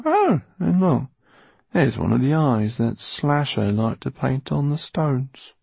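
henchman's character voice, pointing things out; Basta, surly henchman. Acted. Basta showing Mortola the castle's carvings, henchman voice.

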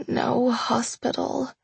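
weak pained groan. A narrator's reading. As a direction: groan it out slowly. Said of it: "I groaned".

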